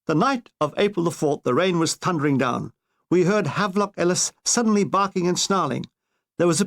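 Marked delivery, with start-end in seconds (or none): none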